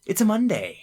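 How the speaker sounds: high playful quote; quoting with roommates. Retold or imitated. Roommates deciding to do something stupid, high playful. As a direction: lift it up high and breezy. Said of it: "we'll just be like".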